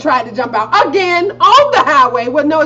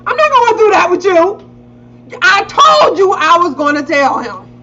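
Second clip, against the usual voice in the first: usual speaking voice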